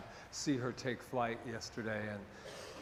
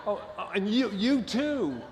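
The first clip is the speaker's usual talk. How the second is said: high, loud mock astonishment; playfully scandalized, pitched well up; acted surprise; 8 semitones higher, 6 dB louder than usual. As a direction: exclaim as if startled by who is there. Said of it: "It's like I'm going".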